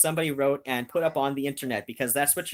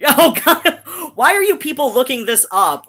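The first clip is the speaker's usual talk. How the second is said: high, loud, laughing disbelief; laughing exasperation; 8 semitones higher, 10 dB louder than usual. Real, not acted. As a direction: laugh and scold the chat at once. Laughing at chat searching it.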